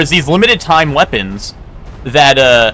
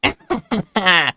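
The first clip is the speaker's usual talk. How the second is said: evil laugh; 5 semitones higher, 5 dB quieter than usual. Acted. Villain laugh before voice line.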